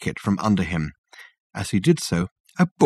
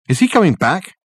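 anxious; 8 semitones higher, 4 dB louder than usual. Acted. Richard anxious at door.

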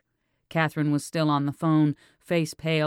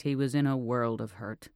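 usual speaking voice

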